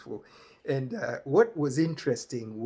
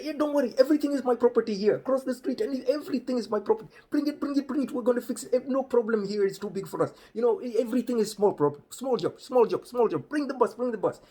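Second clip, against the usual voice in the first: much higher, louder, excited calling; over-eager Rajiv; 11 semitones higher, 7 dB louder, faster than usual. Retold or imitated. Rajiv calling the bus in from the street, high and loud. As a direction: hype guy waving the bus in. Rajiv boasts about his property.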